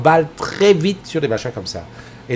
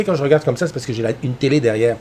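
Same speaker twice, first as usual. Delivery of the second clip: normal talking voice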